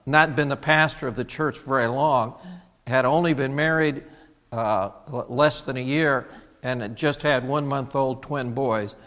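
normal talking voice

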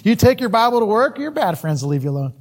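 normal talking voice

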